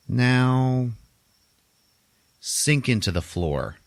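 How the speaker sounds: slow, drawn-out mock command; hypnotic command. Hypnotist sends her patient under, slow retold command. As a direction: stretch each word like a hypnotist giving orders.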